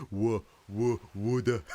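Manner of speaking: loud, exaggerated Aussie "water" attempt; forced Aussie accent. Shown as a demonstration. Learner practising the Australian word water, forced accent.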